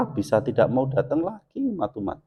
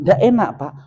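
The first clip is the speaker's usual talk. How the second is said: higher, louder blunt verdict; blunt rejection; 8 semitones higher, 4 dB louder than usual. Retold or imitated. Father bluntly telling his daughter her cooking is bad. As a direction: tell the girl flatly it's bad.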